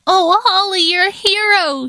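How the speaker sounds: admiring squeal. A narrator's reading. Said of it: "she squealed".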